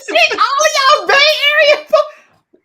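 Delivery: very high, loud, laughing disbelief; squealing, laughing exclamation; amazed outburst. Real, not acted. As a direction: shriek in amused astonishment. Mock outrage at another sultry voice.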